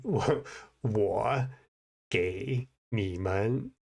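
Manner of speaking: very slow Mandarin with every tone enunciated; word by word, pausing between phrases; slow, clear tones. Shown as a demonstration. Showing careful learner Mandarin, very slow with clear tones. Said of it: "我现在说的很， 很慢".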